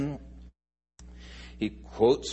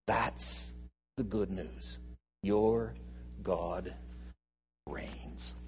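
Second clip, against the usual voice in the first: softer, trailing into a whisper; whispered refrain; 6 semitones lower, 7 dB quieter, much slower than usual. Shown as a demonstration. Preacher closing the point in a hushed whisper. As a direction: hush it, word by word, to a whisper.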